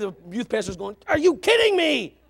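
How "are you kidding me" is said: high, loud disbelief; exasperated outburst. Acted. Youth pastor hearing what kids watched, exasperated. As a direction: cry out in mock exasperation.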